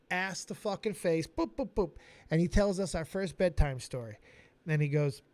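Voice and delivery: ordinary delivery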